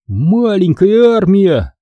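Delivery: plain reading voice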